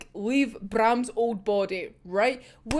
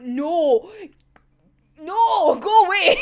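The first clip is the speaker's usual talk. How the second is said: higher, louder "go away" with a laugh; exasperated laughing shout; loud protest; 5 semitones higher, 5 dB louder, slower than usual. Real, not acted. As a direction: shoo him off with a laughing yell. Shooing the villain away.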